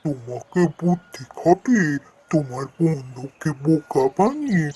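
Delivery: lightly voiced wise old tree, calm; a hint of a gentle talking tree; tree friend voice. Tree friend refuses help.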